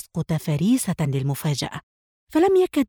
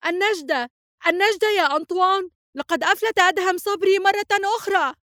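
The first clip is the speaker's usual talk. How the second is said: much higher, frantic cry for help; frantic scream; 13 semitones higher than usual. A narrator's reading. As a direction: scream for rescue as if losing her mind. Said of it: "وصرخت في جنون". (صرخة استغاثة مذعورة بصوت أعلى بكثير)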